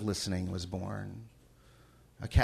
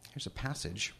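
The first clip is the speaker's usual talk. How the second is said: ordinary delivery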